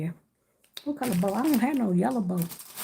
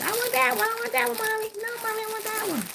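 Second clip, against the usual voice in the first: put-on high pleading child voice, fading softer; whiny kid begging; 12 semitones higher, 3 dB quieter than usual. Mom hears her kid begging for that basket, high whiny child voice. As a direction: beg like a little kid for the basket. Kids begging at egg hunt.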